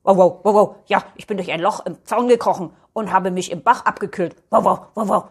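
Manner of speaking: loud, bouncy barks between quick phrases; a talking dog punctuating speech with woofs; chatty barking dog character; lively little dog. Acted.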